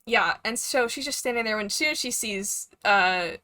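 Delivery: normal talking voice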